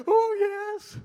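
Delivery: put-on very high, loud childish wail; hammed-up squeaky boy voice; little eight-year-old voice. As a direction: wail the answer as an overwhelmed little kid. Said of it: "my little eight-year-old voice".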